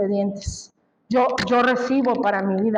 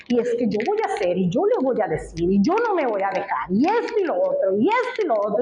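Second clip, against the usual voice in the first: racing thoughts; 2 semitones lower, much faster than usual. Retold or imitated. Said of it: "los pensamientos a todo lo que daba".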